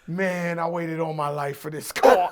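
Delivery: low, measured, dramatic delivery; hushed, low-pitched mock gravity; solemn retold reply. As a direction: drop the voice low and savour it. Retold reply to phone call.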